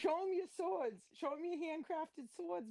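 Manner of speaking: high, egging him on; hyping voice. Retold or imitated. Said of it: "And I'm like".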